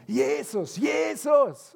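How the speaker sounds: high desperate shout of a blind beggar; calling out. Retold or imitated. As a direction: cry out to get Jesus' attention. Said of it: "fängt an zu rufen".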